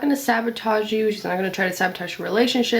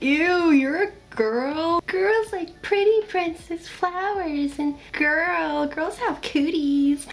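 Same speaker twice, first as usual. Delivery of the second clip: put-on high, drawn-out kid-like jeer; slow singsong teasing, pitched way up; mock a squeamish kid teasing about girls; childish disgusted mockery; 8 semitones higher, slower than usual. Mocks girl-haters with childish acted jeer.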